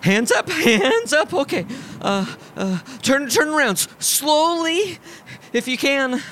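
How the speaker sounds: high, hesitant, stammering orders; a frightened man trying to sound official; nervous. A narrator's reading. Nervous man arrests Arthur.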